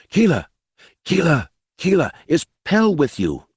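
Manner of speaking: high, urgent woman's voice; anxious mother calling. A narrator's reading. Mother seeking her children, anxious calling.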